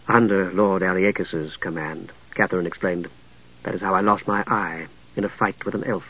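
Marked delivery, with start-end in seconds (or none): 0.1-2.1 low, cultured woman's voice. A narrator's reading. Catherine explains. 3.6-6.1 low, cultured woman's voice. A narrator's reading. Catherine explains.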